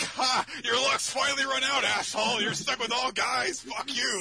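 high, loud mock taunting; gloating guys. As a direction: crow it loudly at a rival. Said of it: "and they're like".

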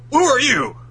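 high, loud startled demand; startled question. Acted. Both Luffys face each other, high startled shout. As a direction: shout it at your double in surprise. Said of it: "And both Luffys are like".

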